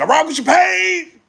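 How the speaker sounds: loud friendly shout-out to Payne; enthusiastic shout-out. Real, not acted. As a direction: call it out to Payne with energy.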